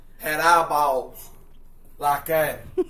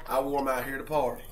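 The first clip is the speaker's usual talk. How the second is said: quicker throwaway repeat of "I wore 'em out here to party"; fast repeat; 4 semitones lower, 5 dB quieter, faster than usual. Acted. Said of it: "repeats the line".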